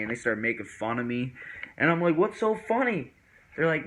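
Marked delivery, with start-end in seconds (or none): 2.2-3.1 high-pitched questioning; retold puzzled protest. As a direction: ask it high, acting out bafflement. Retold puzzled question to teasing friends.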